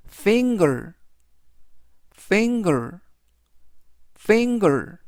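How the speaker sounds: "finger" with a hard g, ending like 걸; GER as 걸. Shown as a demonstration. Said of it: "역시 우리말로 걸".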